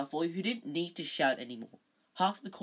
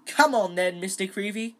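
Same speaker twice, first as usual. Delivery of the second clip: loud, higher, hearty urging; beaming; 5 semitones higher, 9 dB louder than usual. A narrator's reading. Teacher calling the young photographer over, beaming. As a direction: chivvy him along cheerily. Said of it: "beaming at Colin".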